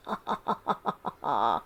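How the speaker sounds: low, sly chuckle; a low chuckle full of cunning; sly fox chuckle. Acted. Fox chuckles to the crow, sly low chuckle. Fox laughs before speaking.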